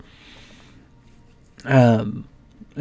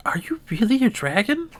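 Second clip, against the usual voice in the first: hushed, higher secret question; whisper; 8 semitones higher, 7 dB quieter than usual. Acted. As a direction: lean in and murmur it like a secret. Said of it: "leans in, whispers in its ear".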